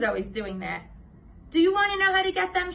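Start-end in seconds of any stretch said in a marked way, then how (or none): 1.5-2.7 high, quick, loud sing-song tease; eager, taunting know-it-all; teasing girl. A narrator's reading. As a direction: dangle the secret in a pitched-up girl's voice. Sharon teases Andrew with secret.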